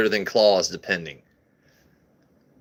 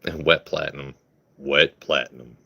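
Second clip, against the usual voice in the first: radio voice; 6 semitones lower than usual. Shown as a demonstration. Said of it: "when you say that with a radio voice".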